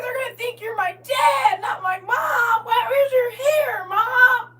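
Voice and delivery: high, drawn-out mock wail; imitating crying daughter. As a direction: wail like an upset kid scolding mom. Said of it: "Baby, she started crying".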